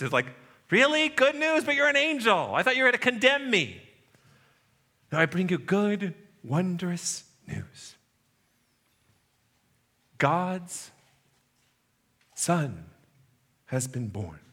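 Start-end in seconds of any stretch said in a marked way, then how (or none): 0.7-3.8 high-pitched, incredulous shepherd; acted disbelief. Shepherd astonished by the angel's news. 5.1-14.4 slow, hushed angelic news; hushed announcement. Acted. Angel solemnly announcing the birth.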